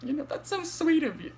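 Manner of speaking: high, teary mock thanks; tearful sarcasm. Crying caller sarcastically thanking the dispatcher, voice high and teary. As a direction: sob out a sarcastic thank you. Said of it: "I start crying hard".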